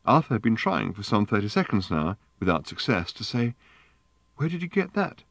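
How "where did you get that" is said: a lightly voiced sharp question with a catch of breath; a high, startled snap of a question; sharp gasping question. A narrator's reading. Arthur tries to ask about the bowl, mildly sharp and startled. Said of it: "sharply, and with a gasp in his voice".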